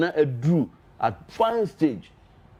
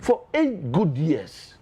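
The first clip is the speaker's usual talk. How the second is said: slow emphasis; much slower than usual. Real, not acted. Guest repeating for emphasis.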